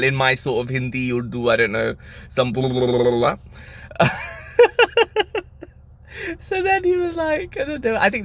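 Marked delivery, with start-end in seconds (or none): 4.2-5.4 squealing high laugh; real giggle. Real, not acted. Laughing at own story. 6.4-8.2 real laughter in the voice; laughing through words. Real, not acted. Laughing while speaking.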